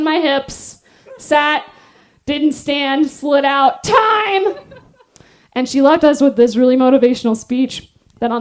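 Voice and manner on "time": called out. Retold or imitated. Instructor calling time.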